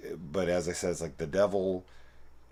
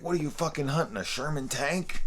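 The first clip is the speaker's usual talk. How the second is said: much higher, disbelieving mock; incredulous armorer; 11 semitones higher than usual. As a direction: gawk at the absurd shopping list. Armorer mocks the order.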